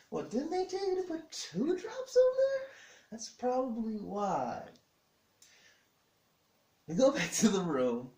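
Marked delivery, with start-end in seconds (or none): 0.1-4.6 very high, loud mock disbelief; squeaked question, then a slow high verdict; incredulous reproach. Husband questioning wife about two drops, incredulous. Husband's pointed reply acted out.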